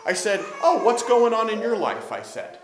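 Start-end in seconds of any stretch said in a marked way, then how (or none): 0.6-2.1 bright, much louder friendly call; loud eager question to a stranger; upbeat greeting. Retold or imitated. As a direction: hail the stranger cheerfully.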